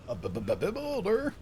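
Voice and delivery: high, slow stuttering disbelief; hammed-up stuttering question; shocked stammer. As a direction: stammer it out, aghast. Character stammers in shock at the word.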